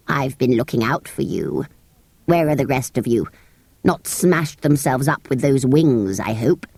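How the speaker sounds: low, grumpy creature voice; gruff sand fairy. A narrator's reading. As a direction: croak it like a cranky old creature.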